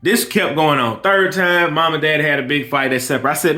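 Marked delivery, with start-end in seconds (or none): none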